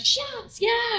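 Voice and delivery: very high party whoop; crowd cheering. Retold or imitated. Bar crowd cheering her contract, high whooping.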